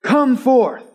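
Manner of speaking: high loud command; commanding shout. Acted. Jesus calling into the tomb, loud command. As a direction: bring him out. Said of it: "all eyes are on".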